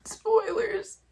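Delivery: ordinary delivery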